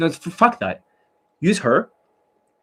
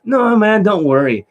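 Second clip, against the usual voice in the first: high and loud, jumping in to reassure; acted friend eagerly calming his buddy; eager reassurance; 9 semitones higher, 6 dB louder than usual. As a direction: brush off a worry with a burst of energy.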